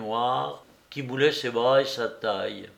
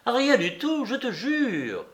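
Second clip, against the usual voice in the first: noisy insistence; 10 semitones higher than usual. A narrator's reading. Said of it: "les affirmations bruyantes de l'employé".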